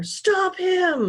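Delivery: high, loud shouted order; shouting. A narrator's reading. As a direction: shout it across the deck. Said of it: "Lyle shouted through the air".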